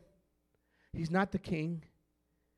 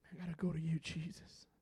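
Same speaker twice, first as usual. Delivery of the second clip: soft, hushed plea, much softer; hushed prayer; 4 semitones lower, 15 dB quieter than usual. Acted. Pastor praying to Jesus, soft hushed plea. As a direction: pray it under your breath.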